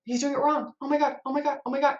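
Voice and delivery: mock panic spiralling in her head; freaking out. Manager hearing a developer's update, panicking inside. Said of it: "starting to freak out in my head".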